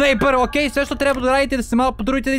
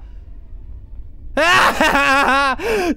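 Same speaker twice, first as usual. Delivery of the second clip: cartoon laugh; 4 semitones higher than usual. Real, not acted. Giddy laugh after win.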